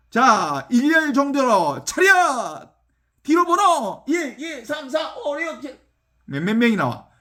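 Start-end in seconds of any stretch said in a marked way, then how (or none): 0.1-5.8 mock drill order, higher and barked; line them up like a drill sergeant; drill-sergeant commands. Woodcutter drills pigs, counting.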